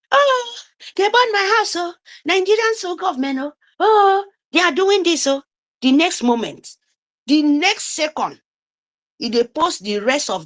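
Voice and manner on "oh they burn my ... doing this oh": high, wailing imitation of a victim crying oh; mock lament. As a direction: ham up a helpless moan about the burned house. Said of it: "They will burn your houses".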